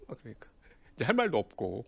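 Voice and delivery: normal talking voice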